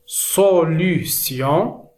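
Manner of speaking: "solution" drawn out syllable by syllable; slow, separated syllables on "solution"; careful word modelling. Shown as a demonstration. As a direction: sound out "solution" slowly for learners. Slow model, then said normally.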